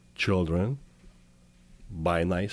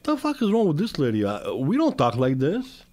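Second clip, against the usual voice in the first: higher-pitched, acted-out indignation; mock-outraged objection, pitched up; indignant reaction; 7 semitones higher, 4 dB louder than usual. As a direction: protest in disbelief, acting it out. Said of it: "I saw, I'm like".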